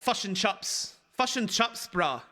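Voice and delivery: mock Kiwi vowels, "fush and chups"; New Zealand accent. Shown as a demonstration. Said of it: "that's New Zealand".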